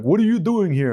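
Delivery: high, lightly imitated Russian accent. Chief operator questioning rescued rowers, imitated Russian accent. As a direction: mimic a puzzled Russian-accented officer. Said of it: "In, like, a Russian accent".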